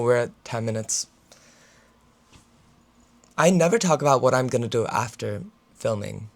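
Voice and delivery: ordinary talk, nothing marked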